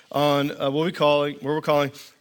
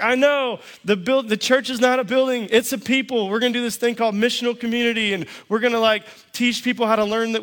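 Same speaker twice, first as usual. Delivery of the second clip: much higher, eager pitch; fired up; 6 semitones higher than usual. Retold or imitated. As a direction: pitch the big dream with excitement. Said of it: "I'm all fired up".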